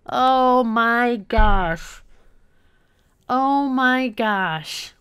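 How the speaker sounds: very slow, stretched-out lament; deflated disbelief. Real, not acted. Gamer taking in the defeat, slow dismay. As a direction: drag each word out, no longer shouting.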